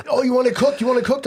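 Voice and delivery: high, loud startled waiter; surprised waiter. Retold or imitated. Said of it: "I'm like".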